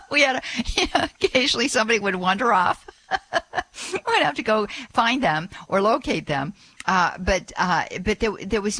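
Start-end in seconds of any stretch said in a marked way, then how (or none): 0.0-6.5 laughing through the words, higher voice; amused laughing. Real, not acted. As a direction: chuckle while telling it, pitch lifted. Amused memory of wandering pilgrims.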